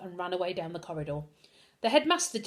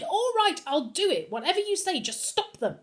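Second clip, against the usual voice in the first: shouted; 9 semitones higher than usual. A narrator's reading. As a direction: give in with a shout. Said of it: "she shouted".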